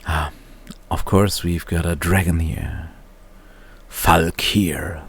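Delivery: ordinary talk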